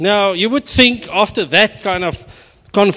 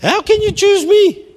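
squeaky falsetto, high and louder; shrill falsetto plea; very high squeak; 6 dB louder than usual. Acted. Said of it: "Sorry, that was a high pitch".

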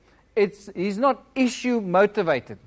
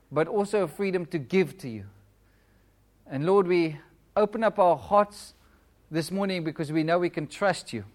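earnest prayer, a little softer, unhurried phrases; congregational prayer; 4 dB quieter than usual. Real, not acted. Pastor leading his church in morning prayer, soft and earnest. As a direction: pray aloud for the room, each Lord a soft landing.

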